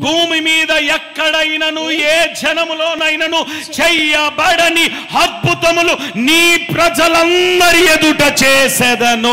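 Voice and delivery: slow, high, loud scripture reading; solemn proclamation. Acted. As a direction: weigh every word of the promise. Reading God's promise aloud.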